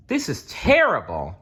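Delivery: a touch of despair at the news; dismayed cry. Acted. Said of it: "Nicholas cried".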